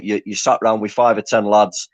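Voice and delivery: normal talking voice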